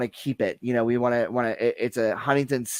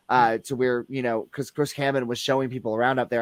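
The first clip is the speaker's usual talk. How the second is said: ordinary delivery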